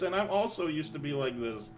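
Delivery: ordinary delivery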